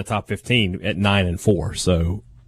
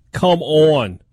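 loud, high Minnesota "come on"; exasperated Minnesota accent; 6 semitones higher, 4 dB louder than usual. Acted. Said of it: "You went into your Minnesota accent for that".

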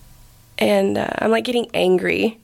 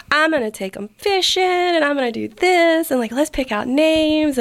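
excited husband; 7 semitones higher than usual. Retold or imitated. Husband dreaming of fishing trips, eagerly gushing. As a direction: play him all dreamy and eager.

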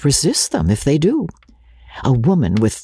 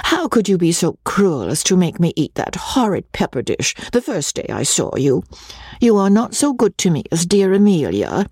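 lightly voiced, higher young lady; Rebecca's coy reproach; 6 semitones higher than usual. A narrator's reading. Guest chides him over the pepper dish, mildly reproachful. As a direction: scold him playfully, pitch lifting on the complaint.

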